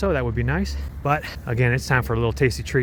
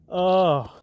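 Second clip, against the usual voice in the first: usual speaking voice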